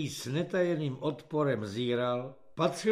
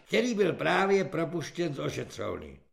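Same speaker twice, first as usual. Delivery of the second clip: usual speaking voice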